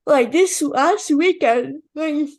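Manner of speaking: speaking through a yawn; words stretched by a yawn; yawning while talking. Real, not acted. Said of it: "You're, you're, you're yawning right now".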